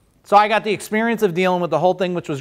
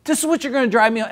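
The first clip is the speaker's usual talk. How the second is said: incredulous; 7 semitones higher than usual. Retold or imitated. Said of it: "I'm like".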